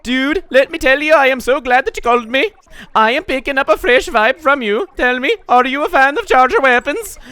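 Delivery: put-on chatty game character in a Scottish accent. Inkling Girl Amiibo pitching charger challenges, in a Scottish accent. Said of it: "I suddenly sound Scottish".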